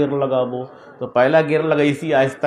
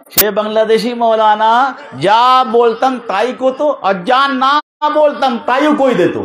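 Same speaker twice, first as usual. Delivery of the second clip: high, raised preaching voice; impassioned preaching; 8 semitones higher, 5 dB louder than usual. Acted. Preacher telling how the maulana translated him, impassioned. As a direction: hold it high and drive each phrase.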